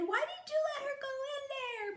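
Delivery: high, tearful mock scolding; imitating mom scolding dad, high and teary; mad mom. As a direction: whine at dad like an upset mom. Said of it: "She got so mad at my dad".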